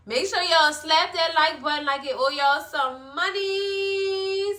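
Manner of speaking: high plug ending on a long held "money"; singsong sign-off. Real, not acted. Host asking viewers for likes, singsong plug. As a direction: chant it, stretching out the last word.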